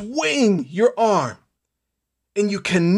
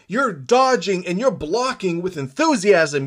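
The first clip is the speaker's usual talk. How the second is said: ordinary talk, nothing marked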